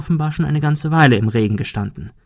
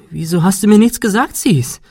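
high, loud and cracking with agitation; overexcited voice cracking; 9 semitones higher, 4 dB louder than usual. A narrator's reading. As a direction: voice breaks upward, worked up. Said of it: "Ihre Stimme überschlug sich vor Aufregung". (aufgeregt, Stimme überschlägt sich)

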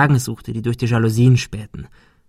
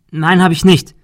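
higher, louder defensive protest; frightened denial; 7 semitones higher, 7 dB louder than usual. A narrator's reading. Girl denies the bully's accusation, frightened. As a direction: protest your innocence.

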